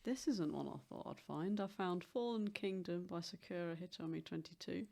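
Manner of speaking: normal talking voice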